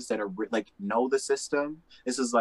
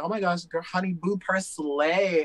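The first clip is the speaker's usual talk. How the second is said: mock gushing, higher and drawn out; 6 semitones higher, slower than usual. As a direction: stretch it out as a bad slang copycat.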